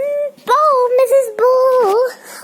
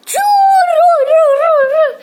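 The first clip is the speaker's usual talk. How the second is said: vehicle noise; 5 semitones higher, 5 dB louder than usual. Pretend. Doctor driving over.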